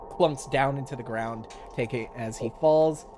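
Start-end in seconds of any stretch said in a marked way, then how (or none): none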